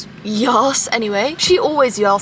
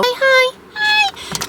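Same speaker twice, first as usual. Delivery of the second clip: squeaky hello; 13 semitones higher, much slower than usual. Pretend. Squeaky greeting to camera.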